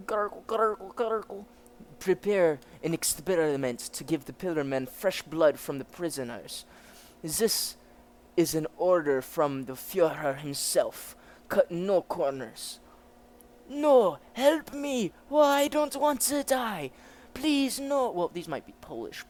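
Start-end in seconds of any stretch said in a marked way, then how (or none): 0.1-1.5 higher, put-on gurgling noise; spoken gurgle. Reader voicing a sound effect, mock gurgling. 2.0-12.8 emphatic orders in a put-on German accent; German officer character. Officer passing on the Führer's order, commanding. 13.7-18.1 high, hammed-up cries for help; panicked begging. Prisoners begging not to die, mock panic. Prisoners beg for their lives.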